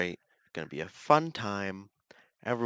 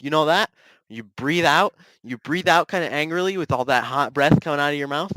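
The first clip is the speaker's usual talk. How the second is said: ordinary delivery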